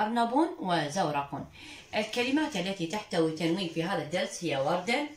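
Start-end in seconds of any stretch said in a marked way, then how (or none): 0.0-1.5 أرنب وزورق as arnabun, zawraqun; tanwin damm endings. Shown as a demonstration. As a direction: end both words of أرنب وزورق in a sounded -un. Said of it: "وتنوين الضم مثل كلمة".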